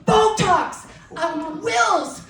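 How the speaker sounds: lightly voiced eager students; mimicking kids. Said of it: "they start just saying".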